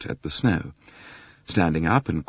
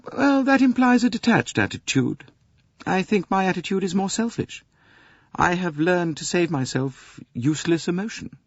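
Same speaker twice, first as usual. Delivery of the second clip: higher, cool and self-contained, lightly played; a hint of guarded composure; lightly voiced young Englishwoman; 14 semitones higher than usual. Mary deflects Poirot's compliment, lightly voiced and cool.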